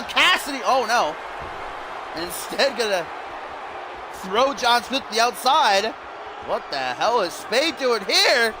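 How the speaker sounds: high-pitched running wrestling commentary; excited play-by-play. Real, not acted. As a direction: call the match high and excited, peaking on big moves.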